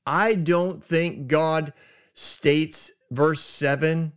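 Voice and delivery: ordinary talk, nothing marked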